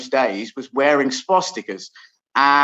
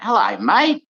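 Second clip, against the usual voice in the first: Australian voice; 5 semitones higher, 3 dB louder than usual. Retold or imitated. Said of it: "a little Australian voice".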